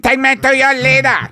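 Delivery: high, loud and fast alien demand. Pretend. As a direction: bark the classic alien line up high. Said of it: "Italian alien".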